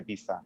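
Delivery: "pizza" as some Spanish regions say it; "pizza" without the Italian T S; regional pizza pronunciation. Retold or imitated. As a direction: imitate a friend's local way of saying "pizza". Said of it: "una pronunciación de algunos sitios".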